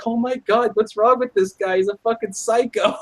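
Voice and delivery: put-on high, appalled girlish voice; mock horrified girl; shocked girls. Girls react to drunk.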